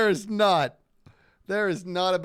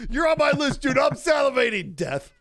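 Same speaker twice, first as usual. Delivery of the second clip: higher, louder gloating threat through laughter; mock trash talk; 9 semitones higher, 9 dB louder than usual. Retelling his trash talk to the player who killed him, loud and laughing. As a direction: ham up a villain's boast, laughing. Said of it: "my crap talk".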